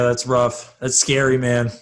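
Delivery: ordinary delivery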